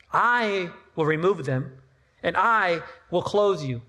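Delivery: "I" drawn out and set apart, slow; stress on I. Retold or imitated. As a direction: lean long and firmly on each "I". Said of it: "Who's the emphasis on?".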